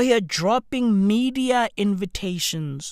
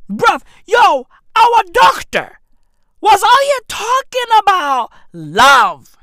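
loud, high, exasperated outburst; outraged rant; 8 semitones higher, 8 dB louder than usual. Retold or imitated. Patient complaining about his doctor's chatter, loud outrage. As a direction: shout it in disbelief.

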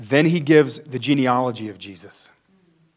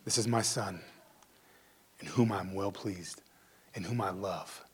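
tender God's voice; 5 semitones lower, 14 dB quieter than usual. Retold or imitated. God speaking to Jesus, softly tender. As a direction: say it like a father's blessing.

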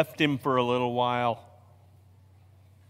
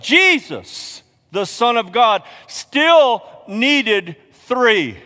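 much higher and louder, very slow on still needed three; preaching at full force; 7 semitones higher, 8 dB louder, much slower than usual. Shown as a demonstration. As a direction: drive the point home, stretching every word. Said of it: "because that's my point".

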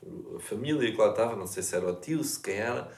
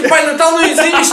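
high, loud laughing cry; mock announcing the truth while laughing; laughing shout; 15 dB louder than usual. Joking punchline, laughing.

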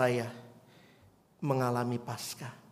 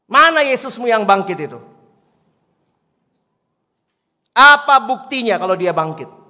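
loud, scornful shouting challenge; angry old man; 6 semitones higher, 14 dB louder than usual. Retold or imitated. Old man angrily mocks the girl's faith, shouting. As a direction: bark it at the child in contempt. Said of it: "Dia bilang".

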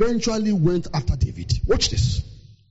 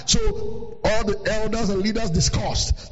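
normal talking voice

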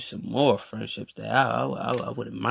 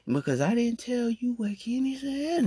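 normal talking voice

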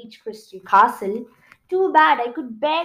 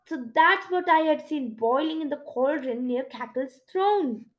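usual speaking voice

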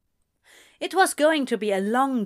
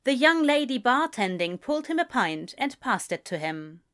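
ordinary delivery, nothing marked

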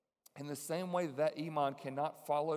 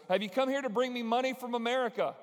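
loud, high calling out; yelled out; 9 semitones higher, 6 dB louder than usual. Retold or imitated. Imam calling out to the Americans, loud and high. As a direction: call it out across the yard. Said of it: "he yelled out".

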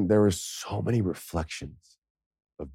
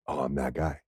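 low and hushed, sinking in; stunned realization; 5 semitones lower, 9 dB quieter than usual. Retold or imitated. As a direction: drop to a small, ashamed voice.

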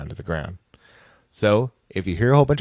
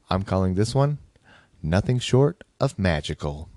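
ordinary talk

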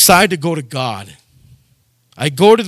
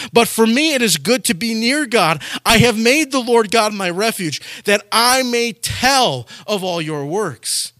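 verse quoted higher in preaching voice; preached quotation; 7 semitones higher than usual. Acted. Pastor proclaiming the verse to his flock, higher fervent preaching. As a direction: proclaim the verse, slowing at the end.

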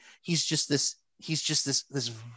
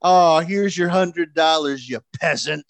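Ted DiBiase taunting; 6 dB louder than usual. Retold or imitated. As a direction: order the fan around like a rich villain who owns him. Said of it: "Ted DiBiase's like".

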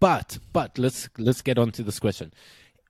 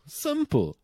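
emphatic repeat; 4 dB quieter than usual. Shown as a demonstration.

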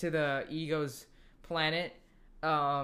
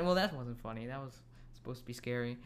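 ordinary talk

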